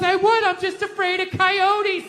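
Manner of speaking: high, slow mock-timid wife; wife making a flimsy excuse, mock-timid; imitating wife's excuse. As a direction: play the wife pleading, wide-eyed. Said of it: "She goes".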